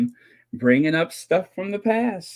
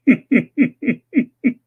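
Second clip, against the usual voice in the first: loud laugh; 8 semitones higher, 6 dB louder than usual. Real, not acted. Laugh after rehash joke.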